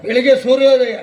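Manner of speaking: loud, emphatic comic correction. Acted. Correcting a companion's moonrise blunder, loud and emphatic. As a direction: blurt the correction out at full volume.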